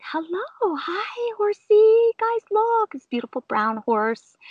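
put-on high-pitched baby talk to an animal; imitating a singsong hello to a horse; cooing horse greeting. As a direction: coo sweetly way up high.

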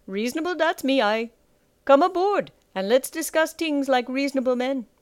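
put-on swaggering call with the Jarl's accent; thick Jarl's accent. A narrator's reading. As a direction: invite them over in a thick Jarl's accent. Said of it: "He spoke with the Jarl's accent".

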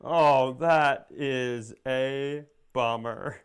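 usual speaking voice

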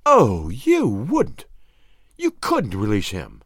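slow, drawn-out swooping plea; dismayed woman's protest. A narrator's reading. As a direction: plead against it, stretching the words. Nan protests his threatened release.

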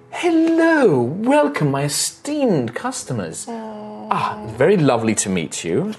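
high, gracious shopkeeper voice; a lightly voiced noble elf host; elegant elven lady.

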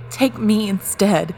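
choked out. Acted. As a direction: force it out, desperate and loud. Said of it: "Jake choked out".

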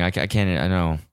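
ordinary delivery

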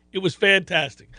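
ordinary talk, nothing marked

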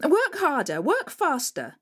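high, harsh barked orders; wicked stepmother. Acted. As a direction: bark the orders at her. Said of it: "said the stepmother".

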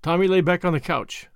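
plain reading voice

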